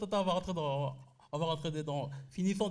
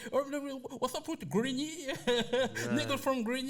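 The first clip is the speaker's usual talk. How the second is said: high-pitched mock American fans raving; excited foreign fans; 7 semitones higher than usual. The whole world talking about Grigny, high hyped American impression. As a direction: imitate hyped Americans in a raised voice, half laughing. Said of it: "le monde entier est en train de parler".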